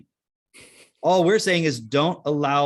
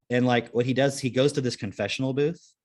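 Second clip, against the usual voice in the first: ordinary talk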